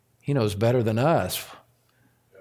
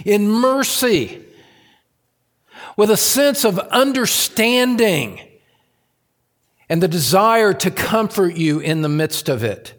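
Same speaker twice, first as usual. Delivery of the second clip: impassioned pulpit cry, much higher and louder; fervent sermon voice building to a shout; preaching at full force; 8 semitones higher, 5 dB louder than usual. Acted. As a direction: preach it out to the back row. Fervent list of Christ's mercy.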